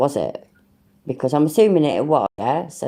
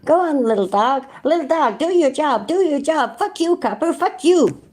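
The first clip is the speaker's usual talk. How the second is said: mocking impression of Chiller; 10 semitones higher than usual. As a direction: sneer it up high like a cocky lout. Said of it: "That's basically what you say".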